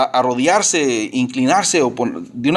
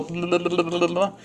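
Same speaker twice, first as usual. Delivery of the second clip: soft, higher tongue-rolling babble; mock nonstop chatter; 3 semitones higher, 6 dB quieter than usual. Preacher showing people who only talk in prayer, mock babbling. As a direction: babble quickly and quietly without words. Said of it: "Y nomás estamos hablando".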